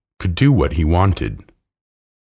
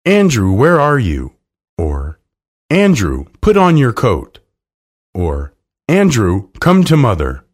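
fond calling out, raised voice; loud calling; 4 semitones higher, 4 dB louder than usual. Acted. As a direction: call the dog across the house. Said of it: "they could hear her loud voice".

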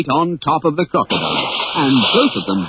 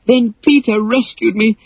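much higher, bright breathless relief; joyful girl; 11 semitones higher than usual. Acted. Daughter telling her father her adventure, overjoyed. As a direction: tumble it out, thrilled to be home. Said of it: "threw her arms joyfully".

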